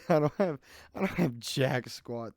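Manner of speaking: loud laughing admission. Real, not acted. Vlogger laughing at having nothing to say. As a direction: laugh through the words.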